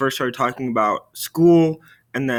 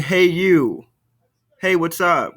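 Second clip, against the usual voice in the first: casual; 4 semitones higher, 4 dB louder, slower than usual. Shown as a demonstration. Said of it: "We're not just saying".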